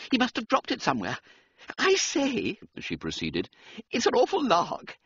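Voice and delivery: put-on high, quick little girl; a gleeful child sharing a secret; cheeky schoolgirl. Young Mannering child speaks.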